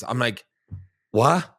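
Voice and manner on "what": higher, louder baffled "what"; mock-incredulous blurt; retold bafflement. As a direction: act out being thrown by the answer. Retold baffled reaction to his answer.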